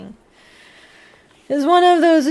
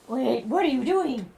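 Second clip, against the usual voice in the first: normal talking voice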